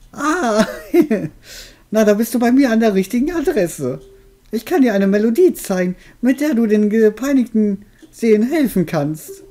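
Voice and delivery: put-on high, cackling old witch. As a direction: play the friendly old witch. Witch continues talking.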